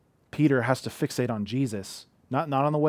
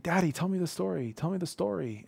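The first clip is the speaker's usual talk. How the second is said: put-on child pleading; 7 semitones higher than usual. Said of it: "he's like".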